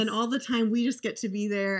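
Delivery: ordinary talk, nothing marked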